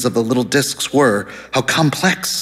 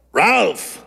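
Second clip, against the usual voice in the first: lightly voiced boisterous caller, pitched up; loud, hoarse man; 7 semitones higher than usual. A narrator's reading. Man phoning who he thinks is Ralph, mock loud and hoarse. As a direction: call it out high, a hint of the hoarse man. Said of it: "voice was loud and hoarse".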